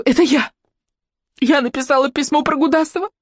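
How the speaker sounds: high-pitched, anguished confession; distraught female cry, pitched right up; desperate outcry. A narrator's reading. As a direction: blurt it out in despair. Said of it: "отчаянно выкрикнула Мотя".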